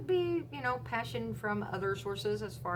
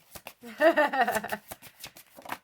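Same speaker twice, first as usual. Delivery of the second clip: loud laugh; 5 semitones higher, 9 dB louder than usual. Real, not acted. Laughs at drawn card.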